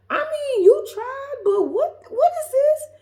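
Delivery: very high, squeaky bafflement; hammed-up high-pitched dismay; baffled disbelief. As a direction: squeal it, trying to be polite. Reacting to a bad breakfast.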